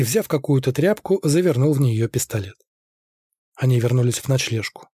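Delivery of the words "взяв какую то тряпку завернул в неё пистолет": plain reading voice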